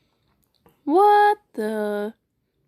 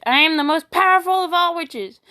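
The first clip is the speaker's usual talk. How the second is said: higher, louder theatrical boast; grand proclamation; 6 semitones higher, 4 dB louder than usual. Shown as a demonstration. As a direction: proclaim it like a triumphant witch. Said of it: "My two moods".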